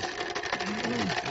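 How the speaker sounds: clearing a rough throat. Real, not acted. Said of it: "excuse me there".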